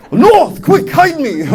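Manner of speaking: high-pitched urgent joke plea; mock alarm. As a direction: pretend to be a fugitive caught out. Acts a fleeing North Korean.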